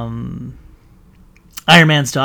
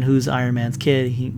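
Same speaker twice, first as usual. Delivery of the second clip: ordinary talk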